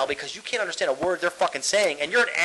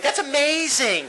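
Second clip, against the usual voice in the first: high, slow mock-mom praise; drawn-out, high parody of a proud mom; gushing mom; 6 semitones higher, slower than usual. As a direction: gush like an over-impressed mother. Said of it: "But now moms be like".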